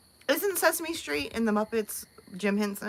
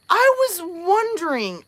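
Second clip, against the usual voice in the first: surprised; 9 semitones higher, 7 dB louder than usual. Real, not acted. Surprised reading viewer's name.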